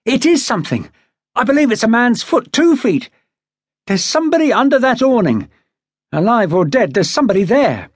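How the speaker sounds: he can't hide it anymore, let the pitch climb; excited. A narrator's reading. Watkins telling the captain there's a body in the boat, openly excited. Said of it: "unable to disguise his excitement".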